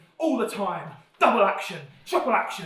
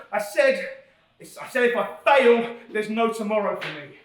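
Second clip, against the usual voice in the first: usual speaking voice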